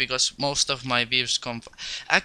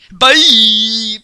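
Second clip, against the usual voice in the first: loud cheerful goodbye; 8 semitones higher, 13 dB louder than usual. Real, not acted.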